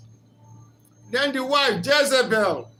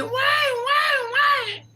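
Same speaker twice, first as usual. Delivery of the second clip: put-on very high, loud wailing baby voice; imitating a crying baby; 4 dB louder, much slower than usual. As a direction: wail it like a baby crying for its mother.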